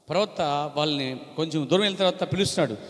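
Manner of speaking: ordinary talk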